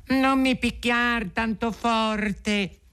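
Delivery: very high, slow little voice; pleading tiny voice. A narrator's reading. Talking wood begs the carpenter not to hit hard, tiny pleading voice. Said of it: "sentì una vocina sottile".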